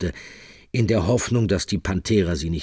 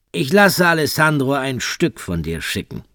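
higher, louder cruel threat; menacing taunt; 8 semitones higher, 5 dB louder than usual. Acted. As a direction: taunt her with relish. Michele taunts Rosa with threat.

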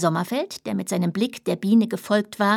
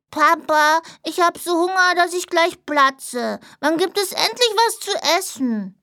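put-on high, whining child voice, slowing on platze; whiny little boy; 7 semitones higher than usual. Hungry little son nagging dad for dinner, whiny child. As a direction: plead like a starving small boy. Young son begging father for food.

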